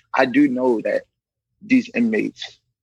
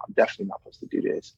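quick whispered confession; whispered aside; 7 dB quieter, faster than usual. Real, not acted. Telling the host he broke a rule, in a quick whisper. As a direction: whisper it fast like a secret.

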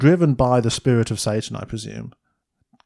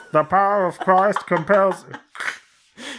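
slow high megaphone call; drawn out, high and slow, over a loudspeaker; through a megaphone; 7 semitones higher, slower than usual. Pretend. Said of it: "He's like".

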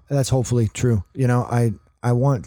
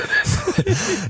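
high laugh. Real, not acted. Laughing at being called small.

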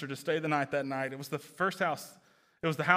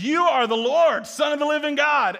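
voicing Peter; 11 semitones higher, 12 dB louder than usual. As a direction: proclaim it like Peter seeing the truth. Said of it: "Peter ... says".

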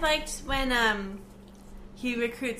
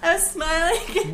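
laughing; 6 semitones higher, 5 dB louder, slower than usual. Real, not acted. Laughing after hitting teeth.

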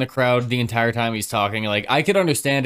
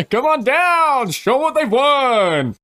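loud, high, drawn-out announcer parody; hammed-up prize-show call; game-show announcer; 6 dB louder, slower than usual. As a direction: belt it out slowly to the studio audience.

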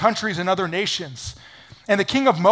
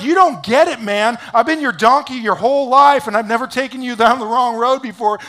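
raised, acted exasperation; pleading donkey; 5 semitones higher, 5 dB louder than usual. Loyal donkey arguing with Balaam, acted exasperation. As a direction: argue back as the wronged donkey.

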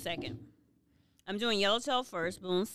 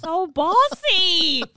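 teasing; 9 semitones higher, 11 dB louder, much slower than usual. Real, not acted. Teasing the host's order.

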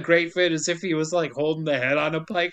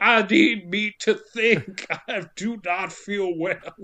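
slow, lumbering dumb-guy voice; dim brute; slower than usual. Retold or imitated. Prisoner begging for hamburger, slow dopey voice. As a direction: drag each word like a hungry caveman.

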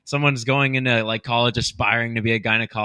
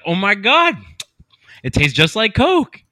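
amazed; 10 semitones higher, 5 dB louder than usual. Acted. Amazed customer in joke.

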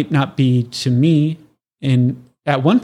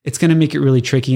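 ordinary talk

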